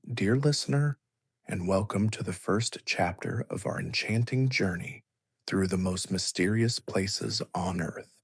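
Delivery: ordinary delivery, nothing marked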